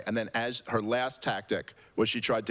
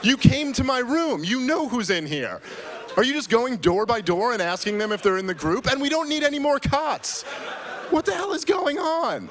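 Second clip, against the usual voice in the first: much higher, louder shouting, racing through it; furious rant; 13 semitones higher, 5 dB louder than usual. Retold or imitated. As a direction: yell at the hotel manager at the door. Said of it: "I was just getting furious".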